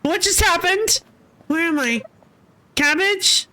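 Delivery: bewildered questioning. Acted. Character wakes confused in a dream.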